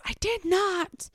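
put-on high, drawn-out child's protest; mock child scream. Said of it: "little lady screams".

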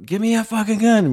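high mock yell at normal volume; retold scream. Husband calls to his wife mid-standoff, mock scream. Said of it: "screaming at Marital".

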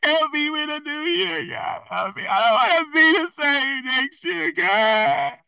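hammed-up high wail to God; mock crying prayer. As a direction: imitate a tearful plea at the altar. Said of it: "should have been at the altar crying".

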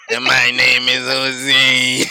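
slow, loud robotic drone. Pretend. Host clowning on the robot voice wish, slow robotic voice. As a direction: drag it out like a robot. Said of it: "If I get a fucking robot voice".

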